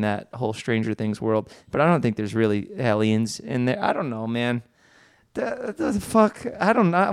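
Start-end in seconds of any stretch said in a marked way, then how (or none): 5.3-7.1 high, slow baffled exclamation. Real, not acted. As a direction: stretch it out, baffled at yourself. Baffled at own rant.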